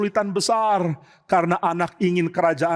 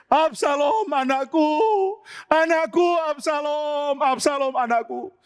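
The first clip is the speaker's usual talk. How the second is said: high wailing lament with a catch of crying; 10 semitones higher than usual. Acted. King David weeping and calling out for his dead son. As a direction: call his son's name in grief, pitched high. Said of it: "sambil teriak menangis".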